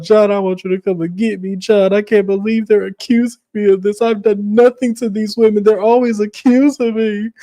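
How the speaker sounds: high-pitched mock wail; hammed-up tearful whining; crying. Lisa begs John to come get her, crying. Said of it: "just crying".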